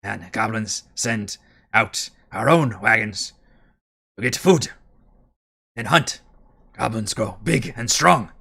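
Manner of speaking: a touch of proud excitement in a light goblin voice; mildly eager goblin bragging; excited goblin boast. Acted. Goblin guard bragging to Thimbleweed about his tribe, mildly excited. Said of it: "excitedly talking about the prowess of his tribe".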